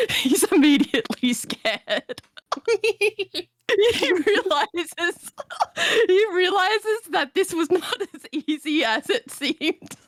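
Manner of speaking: high, laughing narration. Real, not acted. Player tells table his character is scared, laughing. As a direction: struggle to narrate through laughter. Laughs while telling the story.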